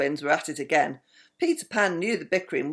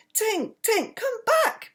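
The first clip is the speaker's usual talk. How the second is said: much higher, pleading call after her; slower than usual. Acted. Peter calling after Tinkerbell flying out the window, high pleading call. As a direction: call her back as she flies off. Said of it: "Peter cried".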